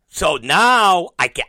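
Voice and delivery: usual speaking voice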